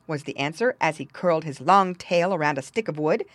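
ordinary delivery

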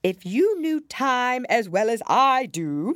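loud, high and drawn-out Hatter; pompous Hatter. A narrator's reading. Hatter lecturing Alice on knowing Time, pompous.

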